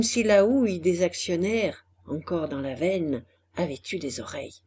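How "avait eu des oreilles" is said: usual speaking voice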